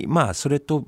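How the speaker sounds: normal talking voice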